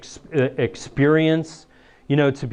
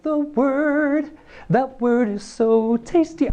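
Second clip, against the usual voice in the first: high, slow sing-song grandma voice; higher, drawn-out old lady tone; imitating grandma; 10 semitones higher, much slower than usual. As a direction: croon it like a doting grandmother. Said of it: "she's like".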